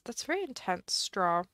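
ordinary delivery

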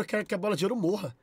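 ordinary talk, nothing marked

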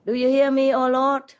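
man hanging from a branch yelling to God; shouting to heaven. Retold or imitated. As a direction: call out desperately from the cliff. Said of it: "the atheist shouted out".

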